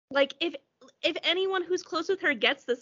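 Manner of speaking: usual speaking voice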